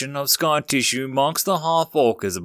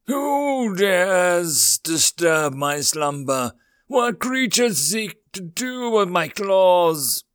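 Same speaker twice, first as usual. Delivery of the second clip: slow, higher, booming dragon voice; hissing reptile growl; 6 semitones higher, slower than usual. Acted. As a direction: speak as an ancient dragon, slowly. Dragon speaks from its hoard.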